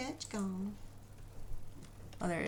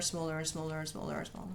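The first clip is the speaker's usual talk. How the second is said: fading to a whisper; 3 semitones lower, 6 dB quieter than usual. Pretend. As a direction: get softer each time, ending in a whisper. Shrinking strokes, voice shrinking too.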